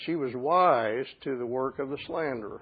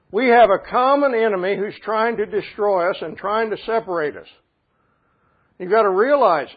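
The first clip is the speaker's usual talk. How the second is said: loud, higher preaching voice building to a plea; fervent preaching; 5 semitones higher, 8 dB louder than usual. Acted. Pastor urging believers they are not enemies, fervent loud preaching. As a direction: plead with the congregation.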